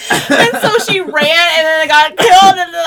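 high, loud and laughing, slowed; real excitement spilling over in laughter; excited. Real, not acted. Telling friends about the movie ending, excited and laughing.

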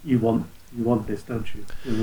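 soft, sultry, teasing come-on; tempting devilish whisper. Retold or imitated. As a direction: purr it like a seductress.

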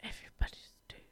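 hushed whisper. Acted. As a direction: breathe it out in a whisper. Eerie end of a dark story.

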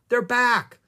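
put-on girl spotting the rangers, calling out with joy; excited cry. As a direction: call out to the returning rangers. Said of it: "cried Annie".

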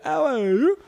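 loud, high mimicked call; high catchphrase imitation. Friend confirming the catchphrase, high loud mimic. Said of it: "exactly that".

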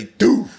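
high, punchy imitated drum thump; drum-like punch sound. Shown as a demonstration. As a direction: mimic a fist landing like a drum. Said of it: "I'm going to describe how it sounded".

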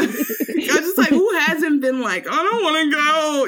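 high, laughing whine. Real, not acted. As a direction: giggle out the words. Laughing at her own past.

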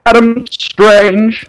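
high, stretched-out heroic announcement; grand cartoon announcer intoning a name; mock announcer. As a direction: proclaim the name slowly and high.

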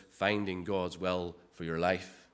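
"your life" set apart with deliberate weight; low, measured phrase pointing up "your life"; stress on the personal. Shown as a demonstration. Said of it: "it's the emphasis".

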